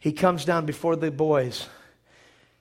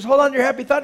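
urgent encouragement; 7 semitones higher, 6 dB louder, much faster than usual. Retold or imitated. Tinkerbell urging Peter on.

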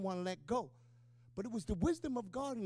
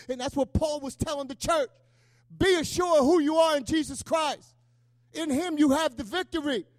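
loud, raised, driving sermon delivery; full-force preaching; 6 dB louder than usual. Real, not acted. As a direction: proclaim scripture to the church with force.

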